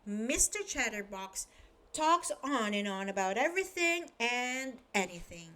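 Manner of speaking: plain reading voice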